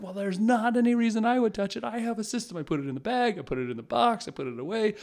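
hammed-up dad defending his system; indignant rant. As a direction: lay out his system like a wronged man. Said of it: "ranted about the house".

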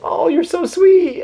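very high, slow sugary sweet; mock cooing. Megan to a crying super fan, high slow coo. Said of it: "she was like".

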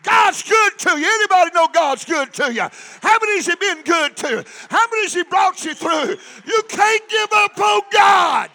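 loud, rising shouted sermon; preaching at full force. Acted. Preacher rallying the congregation, full-force shouted preaching. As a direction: build the congregation up, hammering each line.

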